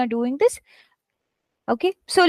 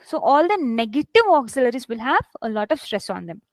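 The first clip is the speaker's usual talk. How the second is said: ordinary talk, nothing marked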